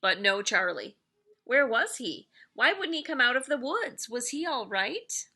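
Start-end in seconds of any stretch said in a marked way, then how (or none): none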